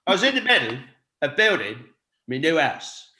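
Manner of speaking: halting Cockney film-star delivery with pauses between phrases; deadpan impression, measured out phrase by phrase; Michael Caine. Shown as a demonstration. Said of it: "random Michael Caine, Caine impression".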